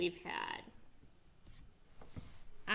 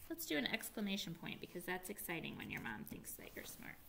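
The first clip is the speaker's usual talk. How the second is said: whispered aside; 3 semitones lower, 11 dB quieter, faster than usual. Real, not acted. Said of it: "whispered aside while writing".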